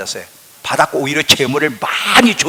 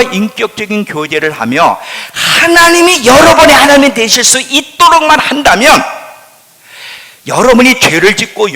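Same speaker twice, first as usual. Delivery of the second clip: loud, high impassioned preaching; preaching at full force; 6 semitones higher, 7 dB louder than usual. Acted. Preacher urging the church about God's protection, loud and fervent. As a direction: lift the congregation with fervour.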